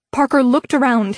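usual speaking voice

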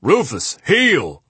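loud barked command, drill sergeant; drill instructor shout. A narrator's reading. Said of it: "shouted in a deep drill instructor voice".

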